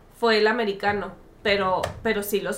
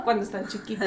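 ordinary talk

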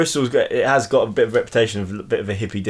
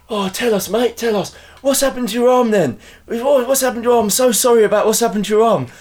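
very high-pitched mimicked busybody; imitating shouting stranger; 12 semitones higher than usual. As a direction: pester someone in a high put-on voice. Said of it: "someone starts shouting at me".

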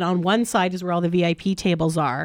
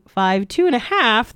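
ordinary talk, nothing marked

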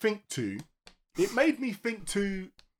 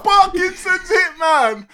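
ordinary delivery

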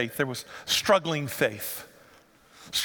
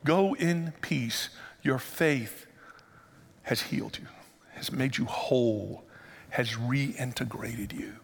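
slow, soft and tender, fading softer; gentle blessing; 4 semitones lower, 5 dB quieter, slower than usual. Acted. Preacher voicing Jesus blessing the healed woman, slow and tender. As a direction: speak peace over her gently.

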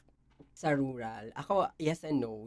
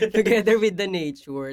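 laughing, louder repeat of the phrase; 4 semitones higher, 9 dB louder than usual. Shown as a demonstration. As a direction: say it again through a laugh.

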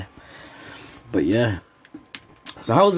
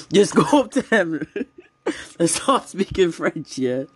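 higher voice breaking into real laughter; laughing; 8 semitones higher than usual. Real, not acted. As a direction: crack up midway through the advice. Cracking up while advising friends.

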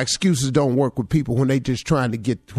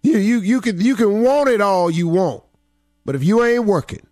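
fired-up motivational preaching; 5 semitones higher, 7 dB louder than usual. Acted. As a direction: build it like a sermon. Motivational speech preaching hard.

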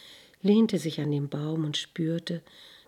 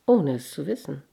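ordinary delivery

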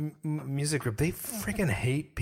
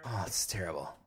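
ordinary talk, nothing marked

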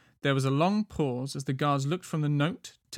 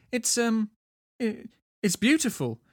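high, hesitant stammer; flustered; 8 semitones higher than usual. A narrator's reading. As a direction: stumble shyly into a compliment. Smitten man hesitates before answering.

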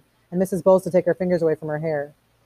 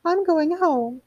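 much higher, fretful quaver; 13 semitones higher, much slower than usual. A narrator's reading. Missus Bowles wanting to flee Montag, quavering high. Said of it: "quavered Missus Bowles".

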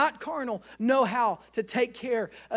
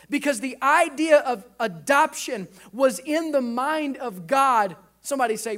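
ordinary delivery

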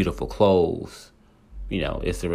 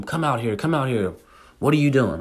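higher-pitched, retold yelling at a closed door; angry calling out; 6 semitones higher than usual. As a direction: call him out, mock angry.

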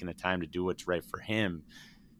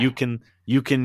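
choked up, pushing through tears; fighting back real tears; teared up, voice breaking; 5 semitones higher, 6 dB louder than usual. Real, not acted. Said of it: "I'm teared up right now".

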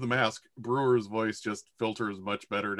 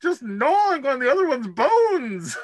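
high, louder put-on voice for Brewer; Brewer's voice; 14 semitones higher, 5 dB louder than usual. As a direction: Brewer gleefully describes the gore, high.

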